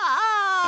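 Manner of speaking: a hammed-up cry of despair; despairing wail. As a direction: wail like a melodrama heroine. Wailing in despair.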